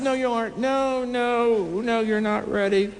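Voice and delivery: high, playful put-off; teasing refusal. Retold or imitated. Preacher telling the CEO he is not ready, high and playful. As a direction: tease him and wave it away.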